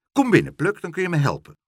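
high, loud summons; commanding. A narrator's reading. Mevrouw Helderder orders Pluk in to help her, commanding. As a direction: order him in briskly. Brusque neighbour orders boy inside.

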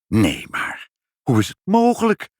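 high-pitched amazement; incredulous, swooping high; astonished exclamation. A narrator's reading. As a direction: cry out in sheer surprise. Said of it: "riep moeder".

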